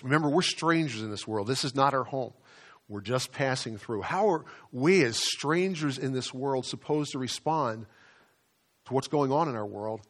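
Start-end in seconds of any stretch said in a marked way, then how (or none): none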